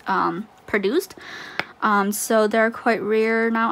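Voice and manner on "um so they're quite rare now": ordinary delivery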